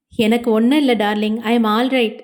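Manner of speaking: ordinary delivery, nothing marked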